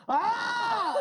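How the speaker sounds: high-pitched yell mimicking a crash reaction; imitated scream. Storyteller re-enacts his shout at the car crash, imitated scream. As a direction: cry out as if just rammed from behind. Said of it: "lo imita cómo gritó".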